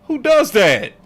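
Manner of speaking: loud, high, exasperated yelling; heated rant. Real, not acted. Host ranting at viewers about bad leadership, angry. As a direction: rant like you're fed up.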